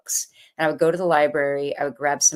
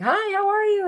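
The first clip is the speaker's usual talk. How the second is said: high, bubbly shop greeting; perky shop lady. Retold or imitated. Cheery shop assistant greeting a stressed customer, perky and much higher. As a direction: chirp a bright hello to a customer. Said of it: "the lady was all perky and was like".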